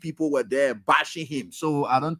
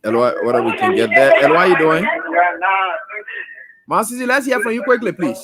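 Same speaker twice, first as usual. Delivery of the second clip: calling out to caller; 3 semitones higher, 7 dB louder than usual. Real, not acted. Host calling caller on air.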